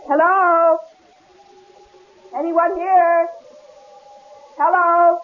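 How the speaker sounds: wife calls into the empty lodge; calling out. Acted. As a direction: call for the owner. Calling into cabin.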